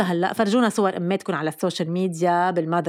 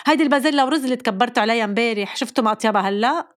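quick, triumphant rattle; fast, pleased told-you-so; gloating mother; faster than usual. Retold or imitated. Mother reveals the leftover peas to her kids, fast and triumphant.

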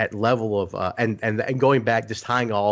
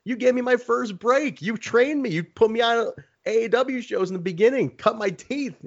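pitched way up, needling a friend; hammed-up teasing, higher; ribbing high voice. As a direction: rib him in a high mock voice. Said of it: "I'm like".

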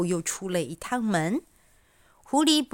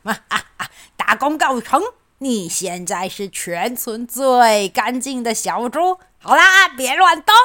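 triumphant fox; 3 semitones higher, 6 dB louder than usual. Acted. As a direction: crow over a finished job. Fox triumphant, pig in pan.

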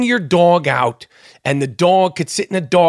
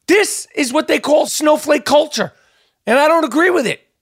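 heated rant; 7 semitones higher than usual. Real, not acted. Ranting against snowflake culture.

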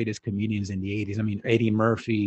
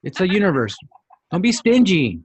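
louder, higher comic protest; retold mock scolding; 7 semitones higher, 6 dB louder than usual. Owner telling girl to share the name, mock scolding. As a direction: scold her in mock indignation, building louder. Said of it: "I was like".

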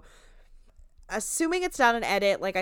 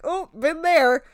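higher, louder knowing quip; a mock been-there remark; sarcastic quip; 8 semitones higher, 7 dB louder than usual. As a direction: toss it off like you've seen it all. Host quotes her own reaction.

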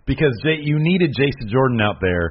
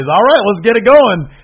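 retold eager call; 8 semitones higher, 8 dB louder than usual. Acting out wrestler eager to start.

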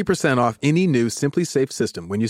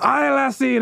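a rough, hammed-up Scottish brogue, pitched up; 10 semitones higher, slower than usual. Mock Scottish brogue imitating Scrooge McDuck for the cohost. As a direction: try a Scottish brogue you admit is bad. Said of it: "I can't do a very good brogue".

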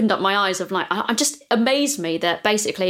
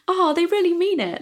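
naive hope; 10 semitones higher than usual. Retold or imitated. Naive reading of rejection letter.

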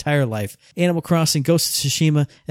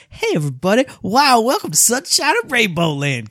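bright mock kids'-show host welcome, higher; mock cheery host voice; 8 semitones higher than usual. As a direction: ham up a sunny cartoon host greeting. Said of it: "Eric goes".

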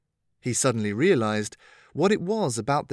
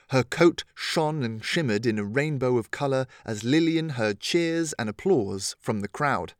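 usual speaking voice

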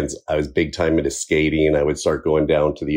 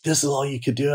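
mock teacher yell; 9 semitones higher, 3 dB quieter than usual. Recalling his fifth grade teacher belittling him, high mock scorn. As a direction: imitate a belittling teacher, pitched way up. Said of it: "she yelled at me".

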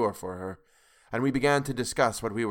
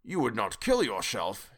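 hoarsely; slower than usual. A narrator's reading. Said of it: "he asked hoarsely".

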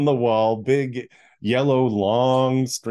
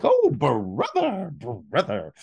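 jolly brother; 6 semitones higher, slower than usual. Retold or imitated. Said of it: "trying to be really jolly for me".

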